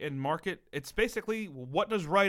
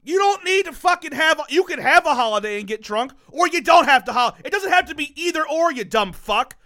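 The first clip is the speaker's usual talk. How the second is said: yelling, much higher and louder; angry shout; 12 semitones higher, 12 dB louder than usual. Real, not acted. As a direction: blow up at him in a real rant. Heated argument, shouting at friend.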